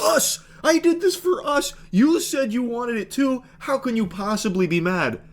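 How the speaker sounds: high whiny mock husband voice. Host parodies the husband's excuse, high whiny mockery. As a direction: whine it up high like a man-child. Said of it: "I like how he puts, like".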